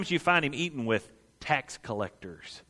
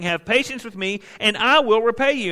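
pleading; 8 semitones higher, 7 dB louder than usual. Acted. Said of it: "began to plead with him".

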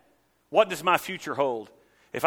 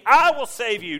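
louder and higher, pleading as God; God screaming at his people; mock screaming God; 9 semitones higher, 6 dB louder than usual. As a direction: shout the promise like God stomping. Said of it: "God's over here stomping and screaming".

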